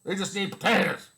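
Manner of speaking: hammed-up mock voice of a snide critic. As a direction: sneer it like the people you despise. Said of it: "Instead of".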